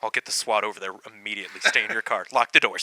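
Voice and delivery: deep serious dispatcher. Acted.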